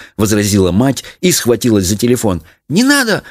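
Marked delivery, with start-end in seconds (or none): 2.7-3.2 put-on very high-pitched child's cry. A narrator's reading. Daughter stopping mother from phoning, crying out. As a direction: cry out high, without much volume. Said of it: "крикнула Леночка".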